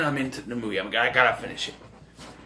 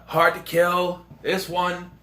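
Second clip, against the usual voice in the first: usual speaking voice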